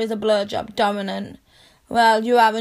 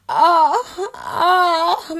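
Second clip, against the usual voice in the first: moaning; 6 semitones higher, 4 dB louder than usual. Acted. Moaning during sex.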